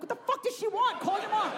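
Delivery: high-pitched frantic parental bafflement; hammed-up helpless alarm; mock panic. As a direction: squeal it in comic desperation. Parent baffled by toddler's Spanish demands.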